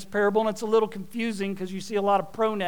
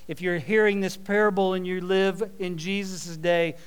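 ordinary talk, nothing marked

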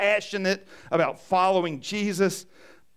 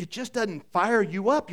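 ordinary delivery

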